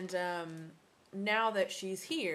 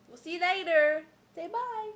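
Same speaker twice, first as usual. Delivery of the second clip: high, loud singsong sign-off; 10 semitones higher, 9 dB louder than usual. Real, not acted. Sign-off voiced for the baby.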